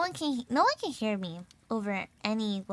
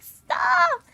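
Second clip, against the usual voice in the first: high, loud wail of embarrassment; hammed-up squeal, then high complaint; mock whiny protest; 11 semitones higher, 7 dB louder than usual. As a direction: protest like a teased daughter. Teased daughter protests to mom.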